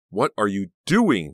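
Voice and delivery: high, loud and slow frightened cry; loud slowed demand to her aunt; shouted. A narrator's reading. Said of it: "Danielle shouted".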